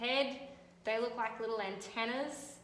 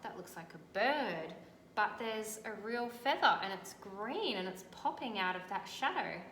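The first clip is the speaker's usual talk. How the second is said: ordinary delivery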